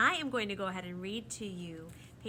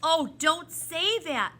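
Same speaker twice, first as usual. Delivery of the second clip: loud, high, drawn-out protest; desperate cry; 9 semitones higher, 15 dB louder, slower than usual. A narrator's reading. Ben protests at being sent home, desperate. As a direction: plead against it slowly and loudly. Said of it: "Ben cried desperately".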